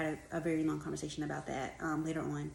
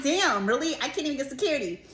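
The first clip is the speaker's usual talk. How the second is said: loud, high incredulous outburst; laughing disbelief, pitched way up; 8 semitones higher, 6 dB louder than usual. Retold or imitated. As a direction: throw up your hands in disbelief. Exasperated disbelief at absent security.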